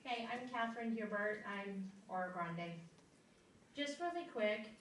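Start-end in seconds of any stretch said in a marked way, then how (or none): none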